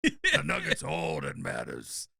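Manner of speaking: hammed-up movie tough guy; Al Pacino. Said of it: "We can get Al in here".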